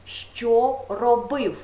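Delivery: що stressed, very slow що робив; slow question. Shown as a demonstration. Teacher repeating the past tense question for pupils, slowly. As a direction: draw out що робив. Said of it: "має запитання".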